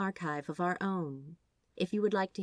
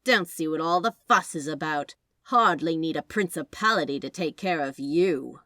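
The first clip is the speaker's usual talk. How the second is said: taunting angel; 3 semitones lower, 9 dB louder than usual. Acted. Angel keeps taunting Crowley.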